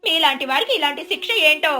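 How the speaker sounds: high, lamenting neighbour voice; pitying neighbour. Acted. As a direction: commiserate with showy pity.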